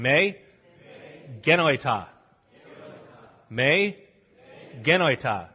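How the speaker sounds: "Me" then "Genoita" said apart for the class to echo; call and response. Shown as a demonstration. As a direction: break "Me genoita" into two parts for the congregation. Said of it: "Say me".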